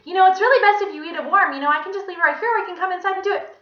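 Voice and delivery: high, loud, rapid lady; insistent Miss Mush. A narrator's reading. Visitor pushing to bring a pie inside, insistent. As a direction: chatter over him fast and high.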